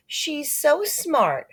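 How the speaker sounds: high, slow gushing praise; admiring mother. Acted. Mother praising Sheila to Peter, high slow gush. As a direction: gush over her in a lifted motherly voice. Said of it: "my mother says".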